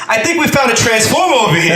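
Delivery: Wahlberg's Boston accent playing a Texan. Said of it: "his Boston accent, being from Texas".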